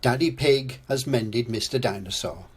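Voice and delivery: usual speaking voice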